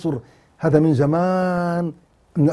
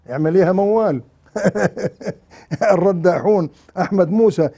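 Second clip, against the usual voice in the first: normal talking voice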